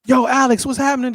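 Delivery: high, punchy phone greeting; high-pitched, hearty hello; upbeat manager. Retold or imitated. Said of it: "And Billy was like".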